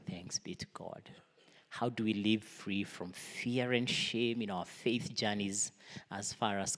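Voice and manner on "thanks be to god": hushed whispered response. Real, not acted. Answering the reading of the word, whispered. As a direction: breathe the response softly.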